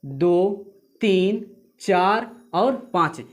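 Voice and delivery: drawn-out counting of each beat; slow counting. Shown as a demonstration. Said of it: "उसको counting नहीं करना है". (धीमी गिनती)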